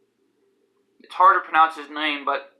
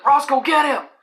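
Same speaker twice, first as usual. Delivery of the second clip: high, raised command to a dog; mock high-pitched attack order; imitating Dohop; 10 semitones higher, 4 dB louder than usual. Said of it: "that was until Dohop said".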